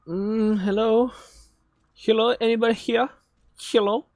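high, singsong hello; high-voiced calling. Gamer checking whether anyone is here, high-pitched calling. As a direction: call out timidly in a high little voice.

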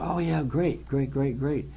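eager, climbing high on the first great; reliving the thrill, bubbling over; excited. Retold or imitated. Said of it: "like, all excited".

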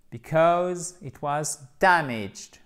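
"because it was damaged" said slowly, stress on "dam"; slow model pronunciation. Shown as a demonstration. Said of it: "prononciation de la suite". ("damaged" lent, accent sur « dam »)

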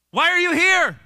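hammed-up insistent question; loud demanding question. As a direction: call it out as a booming challenge. God's question re-voiced, loudly.

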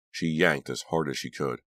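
plain reading voice

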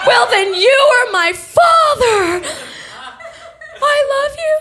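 high, pleading, laughing-crying message; hammed-up tearful plea on an answering machine; desperate voicemail.

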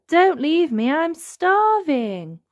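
high wail, slowing and stretched out; pleading call. A narrator's reading. Wilbur calls after the departing elephants, pleading call. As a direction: beg them to come back. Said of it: "called Wilbur".

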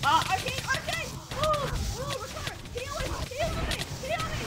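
high urgent calls for healing; desperate plea. Real, not acted. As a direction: beg for heals, panicking. Begging teammate for heals.

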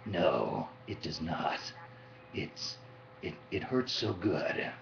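low, hard-boiled soldier voice; Snake voice. Shown as a demonstration. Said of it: "does it hurt to do the snake voice?".

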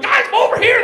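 put-on loud, high holler to kids; high-pitched eager call across a field. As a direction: shout them over excitedly. Calling kids over.

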